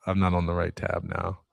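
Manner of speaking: ordinary delivery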